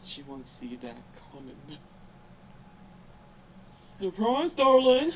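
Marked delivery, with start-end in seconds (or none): 4.0-5.1 loud teasing cry; spring the surprise loudly; gleeful shout. Acted. Character attacks with tickles.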